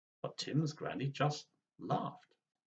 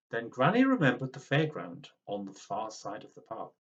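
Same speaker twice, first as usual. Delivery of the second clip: ordinary delivery, nothing marked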